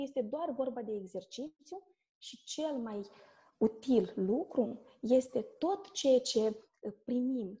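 ordinary talk

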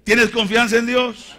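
high, very loud shouted question; insistent shout. Acted. Preacher pressing the believer about trust, insistent shout. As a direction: demand an answer at full voice. Repeats challenge louder, second take.

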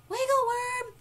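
high, loud squeaky call; squeaky little creature calling a name; Tiny Ant. A narrator's reading. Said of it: "squeaky voice".

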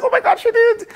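high, loud burst of astonishment; amazed outburst. Retold or imitated. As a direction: relive the thrill, pitch shooting way up. Retells amazed reaction to repost.

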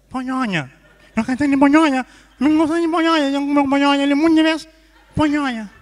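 loud, high, honking nasal insistence; exasperated nasal diner; nasal speech impediment. Acted. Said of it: "Agora vai falar fanhoso".

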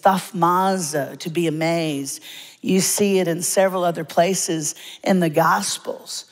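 ordinary talk

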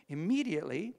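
loud, high, rising Immediately; preacher proclaiming the sudden healing; loud exclaim. Shown as a demonstration. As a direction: ring it out in triumph.

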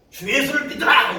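high, booming proclamation; over-forceful shout. Shown as a demonstration. Preacher showing how not to evangelize, booming shout. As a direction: blast it out as the example to avoid. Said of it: "이렇게 하시면 안 되고".

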